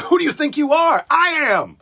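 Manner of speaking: high, loud full-throated yell; shouted retort. Retold or imitated. Yelling the bowler's line at someone, shouted retort. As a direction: bellow it at a jerk. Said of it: "such a great thing to say".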